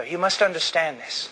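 ordinary talk

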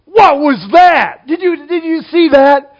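loud, high amazed shout at the TV; loud wide-eyed yell at a nature show; excited yell. Retold or imitated. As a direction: call out in astonishment, drawn out. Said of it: "I'll just yell out".

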